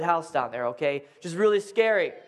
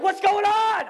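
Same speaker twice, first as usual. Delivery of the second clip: high, loud alarmed yell; alarmed shout; 11 semitones higher, 3 dB louder, slower than usual. Retold or imitated. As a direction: burst in yelling the question. Husband bursts in with machete.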